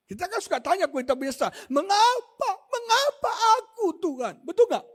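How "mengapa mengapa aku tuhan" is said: mock whining complaint. Imitating people complaining to God.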